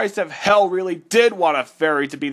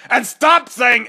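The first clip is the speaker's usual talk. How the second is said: annoyed yell; 6 semitones higher, 5 dB louder than usual. Acted. Annoyed outburst.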